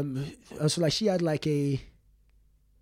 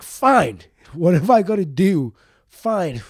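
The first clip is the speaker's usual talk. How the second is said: louder, desperate fine; mock exasperated giving in; 4 semitones higher, 13 dB louder, slower than usual. Child shouting at his beating mother that he gives in. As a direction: give up loudly just to make it stop.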